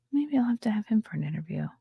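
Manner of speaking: breathy secret aside, no voice; conspiratorial whisper. Real, not acted. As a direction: whisper it as a sly aside to chat. Aside about inviting Gunther.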